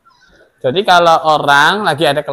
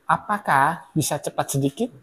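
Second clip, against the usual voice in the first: gentle polite request; 2 semitones lower, 9 dB quieter than usual. Shown as a demonstration. Teacher modelling the polite way to ask a friend, soft and gentle. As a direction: ask nicely, softly, no pressure. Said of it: "Perkataan halusnya".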